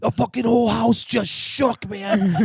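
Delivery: high, loud, slow stressed-out cry; imitating freaked-out buddy. Buddy telling prankster the house shook, imitated freak-out. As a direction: wail it in mock panic.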